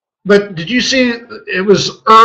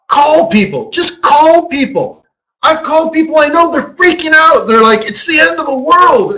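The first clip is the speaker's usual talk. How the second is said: higher, panicked imitation of a yelling pundit; frantic Jim Cramer; 10 semitones higher than usual. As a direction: rant like a pundit losing it on air. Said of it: "he's like".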